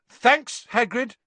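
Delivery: loud, high thanks to cut him off; loudly. A narrator's reading. Harry cutting Hagrid off, loudly. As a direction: say it loud to stop him talking. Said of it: "said Harry loudly".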